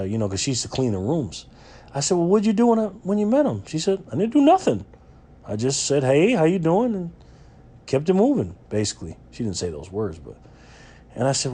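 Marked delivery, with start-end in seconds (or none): 2.2-3.6 put-on kid voice. Kid voiced asking grandma. 4.1-8.6 put-on high, light old-lady voice; a high grandma impression.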